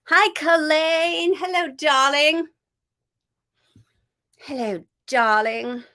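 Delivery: cheerful live-stream greeting; bright greeting. Real, not acted. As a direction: greet a friend joyfully.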